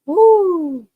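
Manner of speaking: high, loud whoop of amazement; excited whoop. Real, not acted. Reacting to fast UK talk.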